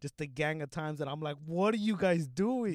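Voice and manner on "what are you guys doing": high, loud, drawn-out disbelief; retold appalled protest. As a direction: call out slowly, as if protesting in shock. Retold protest at police shooting dog.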